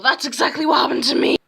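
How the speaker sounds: fast, loud, high exclamation; mock outburst. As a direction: blurt it out in exaggerated exasperation. Mock outburst about being shouted at.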